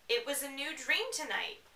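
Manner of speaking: usual speaking voice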